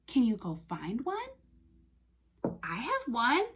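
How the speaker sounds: normal talking voice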